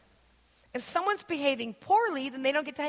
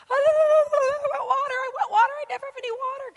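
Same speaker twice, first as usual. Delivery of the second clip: put-on whiny child; 12 semitones higher, 6 dB louder than usual. Mother imitating her whining kid begging for water. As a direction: wail like a thirsty toddler. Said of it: "with my kids".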